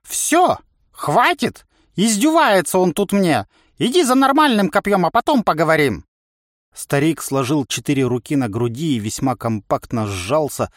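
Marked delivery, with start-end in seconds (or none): 0.0-6.0 put-on high, loud cantankerous old coach; irritated old trainer. A narrator's reading. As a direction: dismiss the trainee in a high, worked-up voice. (раздражённый старый тренер, высоко и громко)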